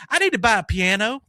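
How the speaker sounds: Lady Boomhauer. Retold or imitated. Customer demands a piano in a high, loud Boomhauer voice. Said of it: "she sounds just like ... Lady Boomhauer".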